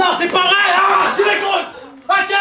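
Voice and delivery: loud high shout; mock outraged shout. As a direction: yell to get them to listen. Shouting at partner in improv.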